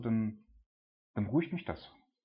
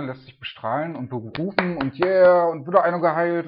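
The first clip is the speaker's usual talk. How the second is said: mock excited; 5 semitones higher, 8 dB louder than usual. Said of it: "als glorreicher Held vor der Menge".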